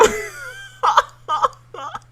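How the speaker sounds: high teary laugh; laughing near tears. Real, not acted. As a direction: laugh until it almost cries.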